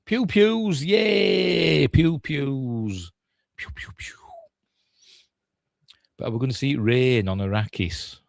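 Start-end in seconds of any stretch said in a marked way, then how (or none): 0.0-3.1 high, drawn-out mock delight; mock childish cheer. Mock cheering at trailer's laser shots. 3.6-4.1 soft, voiceless laser noises; little pew-pew gun sounds under the breath; imitated laser shots.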